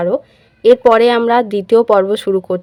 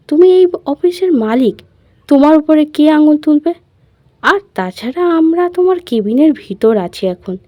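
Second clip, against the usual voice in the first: spoiled dismissive; 7 semitones higher than usual. Acted. Riya dismisses his worry.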